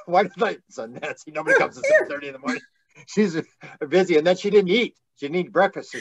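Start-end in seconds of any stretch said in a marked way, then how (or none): 0.7-2.6 high, fast mock scolding voice; scolding gatekeeper. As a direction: play the fussy door guard telling Nancy off.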